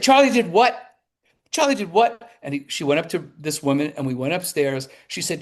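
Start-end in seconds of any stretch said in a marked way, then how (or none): 0.0-2.3 higher, louder outraged question; can't believe what she just heard; shocked disbelief. Retold or imitated. Wife hearing her husband snubbed the journalist, loud shocked disbelief.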